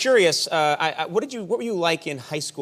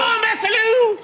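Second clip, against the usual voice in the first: high shout urging a horse on; 4 dB louder than usual. Pretend. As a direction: yell it high and loud, spurring on. Jokey horse-ride shout.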